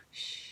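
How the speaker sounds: soft breathy "shh" snores; quiet sleeping-animal snore; friends snoring. A narrator's reading. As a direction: snore in hushed exhales. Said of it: "his friends snore on".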